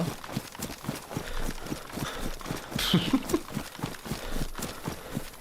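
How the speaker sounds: long breathy wheeze of a laugh, high; silent wheezing laugh. Real, not acted. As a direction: laugh almost without voice, gasping.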